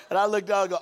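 usual speaking voice

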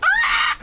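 very high mock pain scream; imitated kid scream. Little kid with arm bent, mock scream.

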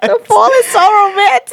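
high laughing insistence. Real, not acted. Arguing fall with her co-host, laughing high. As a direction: insist through laughter, pitch up.

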